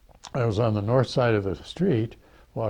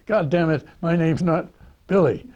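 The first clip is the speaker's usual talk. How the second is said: higher, louder exasperated protest; angry kid's retort; 7 semitones higher, 6 dB louder than usual. Retold or imitated. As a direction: snap back like an annoyed teenager. Kid insisting his name isn't Billy.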